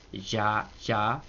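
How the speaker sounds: "Ya, ya" low and soft; soft, low-toned "Ya, ya"; low accent. Shown as a demonstration. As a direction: keep both "Ya, ya" down low and quiet. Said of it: "acento bajo".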